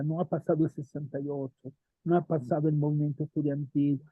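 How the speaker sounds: ordinary talk, nothing marked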